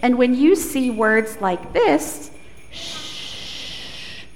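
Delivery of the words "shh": soft unvoiced shh; shushing. Shown as a demonstration. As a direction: hush the children with a long shh. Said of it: "you should whisper the words".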